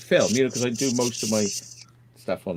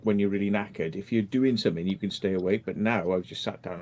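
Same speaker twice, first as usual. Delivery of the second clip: usual speaking voice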